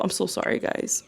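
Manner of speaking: unvoiced sorry; whispered apology. Real, not acted. Apologizing again.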